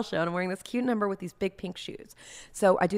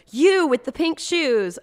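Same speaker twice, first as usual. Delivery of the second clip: higher, louder, slow call across the room; 9 semitones higher, 9 dB louder, slower than usual. Retold or imitated. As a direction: sing it out across a crowded room. Trixie calling out across room.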